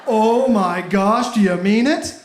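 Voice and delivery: sarcastic fake awe.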